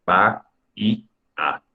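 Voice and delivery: "Ba y a" in three slow, separate beats; slow teacher's split of "Ba y a"; syllable by syllable. Shown as a demonstration. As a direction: break Bahía into ba, í, a with pauses. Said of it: "Bahía tendría tres sílabas".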